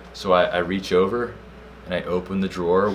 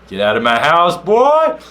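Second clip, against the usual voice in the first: loud holler shooting up high on the last word; hammed-up, high-pitched shout; triumphant mock yell; 10 semitones higher, 11 dB louder, slower than usual. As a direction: yell it after someone running off. Shouting after the fleeing intruder.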